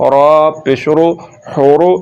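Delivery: ordinary delivery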